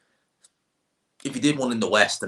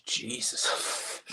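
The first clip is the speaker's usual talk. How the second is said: whispered; 3 semitones higher, 8 dB quieter than usual. Real, not acted.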